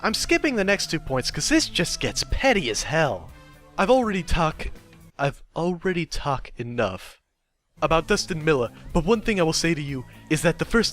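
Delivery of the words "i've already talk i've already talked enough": ordinary delivery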